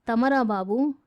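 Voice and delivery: usual speaking voice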